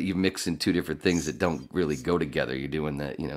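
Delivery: usual speaking voice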